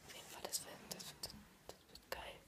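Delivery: whispering. Real, not acted. Whispering beside sleeping friend.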